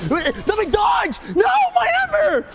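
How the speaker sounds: rising exasperated shout; real gamer frustration; frustrated yell. Real, not acted. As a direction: yell at the controls in dismay. Controls fail during fight.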